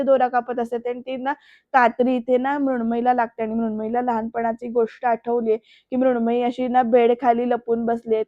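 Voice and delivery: plain reading voice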